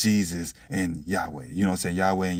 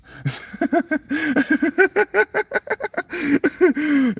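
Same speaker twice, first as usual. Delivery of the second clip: loud high-pitched cackling laugh; real long laugh, high and loud; long high cackle; 4 dB louder than usual. Real, not acted. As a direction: throw your head back and cackle. Cracks up at his own point.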